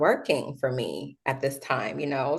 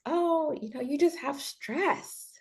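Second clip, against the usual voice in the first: high, soft, slow and patronizing; condescending nurse; 9 semitones higher, 7 dB quieter, slower than usual. Retold or imitated. Mocking the hospital lady who blames stress, soft, high and slow. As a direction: coo it like a nurse who won't take you seriously. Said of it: "the lady is just like".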